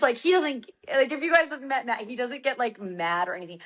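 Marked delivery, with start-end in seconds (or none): none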